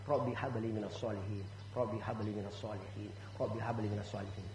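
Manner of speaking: low, hushed repeated prayer; recited prayer. Shown as a demonstration. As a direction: murmur the prayer devoutly. (doa dibisikkan rendah dan lirih)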